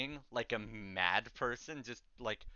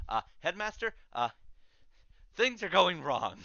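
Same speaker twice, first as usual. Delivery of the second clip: nervous student; 7 semitones higher, 6 dB louder than usual. Acted. Student NPC reports to headmaster.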